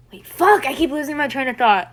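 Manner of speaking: exasperated at losing the train of thought; frustrated outburst. Real, not acted. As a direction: burst out in real frustration. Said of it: "I'm getting frustrated".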